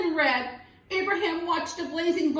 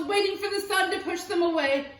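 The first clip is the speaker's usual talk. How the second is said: usual speaking voice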